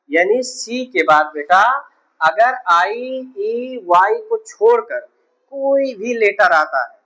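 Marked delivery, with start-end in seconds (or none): none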